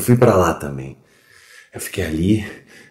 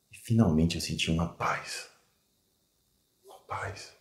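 very soft, lingering, with long pauses; drained, peaceful near-whisper; hushed calm; 8 dB quieter than usual. Acted. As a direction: let it fade almost to a whisper. Recalls calm on window ledge.

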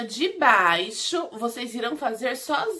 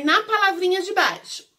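ordinary talk

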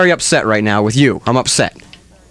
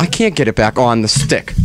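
ordinary delivery

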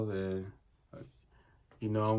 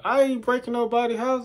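higher-pitched protest in his own voice; indignant denial; 9 semitones higher than usual. Retold or imitated. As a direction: protest your innocence, pitch raised. Same protest before voice goes.

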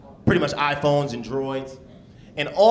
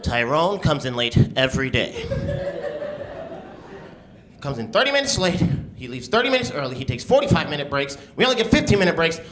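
lightly voiced fed-up coworker; 4 semitones higher than usual. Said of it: "he was like".

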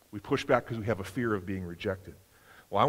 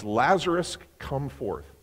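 ordinary delivery

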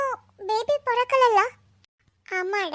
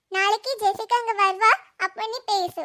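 ordinary talk, nothing marked

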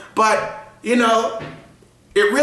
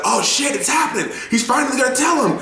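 higher, shouted oh shit it's happening; edge-of-seat excitement; 7 semitones higher than usual. Retold or imitated. Fan at the reveal scene, higher excited shout. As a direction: gasp it out on the edge of your seat. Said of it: "front of my seat like".

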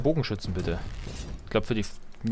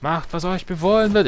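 high, imitated command; unit line impression; 7 semitones higher than usual. Player recalling iconic unit lines, high put-on commanding voice. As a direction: quote it as a medieval game's order, pitched up. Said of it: "hat solche ikonischen Einheitensprüche immer wieder".

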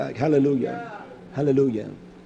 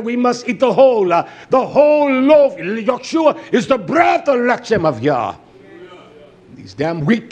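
rousing sermon building to raised peaks; forceful preaching; 7 semitones higher, 6 dB louder than usual. Real, not acted. Preacher urging listeners to obey every command, forceful preaching. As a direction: question the congregation, then drive the answer home.

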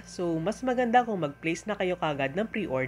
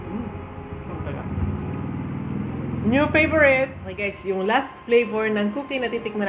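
speaking between bites; talking while chewing. Real, not acted. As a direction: mouth partly full of cookie. Seen 0: Right speaker holds cookie, bites at 4.0, chews between words.

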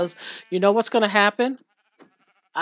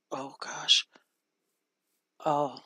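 whisper; 5 semitones lower, 11 dB quieter, slower than usual. Real, not acted. Whispered to herself.

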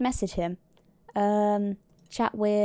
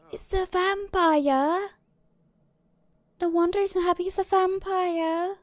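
surprised gasp; 8 semitones higher than usual. Real, not acted. Recognising the vampire visitor.

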